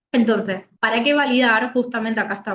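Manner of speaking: normal talking voice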